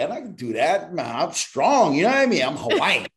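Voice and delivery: high-pitched cocky protest; mock boasting. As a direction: boast like you're invincible, half joking. Acted boast of being strong.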